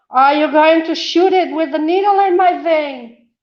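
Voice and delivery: high, loud, alarmed question. Real, not acted. Patient alarmed at nurse's needle, high and loud. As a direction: panicky disbelief, pitched far up.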